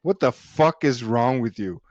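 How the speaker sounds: ordinary talk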